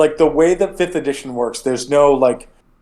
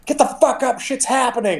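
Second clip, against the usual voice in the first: high-pitched urgent mock yell; yelling to wake someone; 10 semitones higher than usual. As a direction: rouse a sleeping friend in a panic. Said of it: "You hear Ziada be like".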